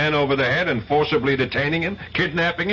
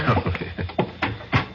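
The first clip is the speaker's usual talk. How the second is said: quiet, breathy little laugh; a low amused laugh; soft chuckle; 3 semitones lower, 4 dB quieter than usual. Acted. Lovers at the door.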